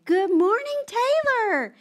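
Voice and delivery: high sing-song greeting; sweet church greeting. Retold or imitated. Church ladies greet little Taylor, high sing-song. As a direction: coo it like a friendly churchgoer.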